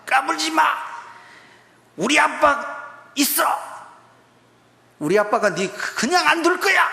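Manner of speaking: put-on crying child's defiant shout. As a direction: shout back like a bullied kid who found his dad. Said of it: "울다가 눈물을 씩 닦으면서". (울먹이며 큰소리치는 아이)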